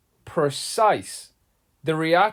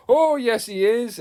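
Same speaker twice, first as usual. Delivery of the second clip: panto audience reply; 8 semitones higher than usual. Retold or imitated. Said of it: "the audience goes".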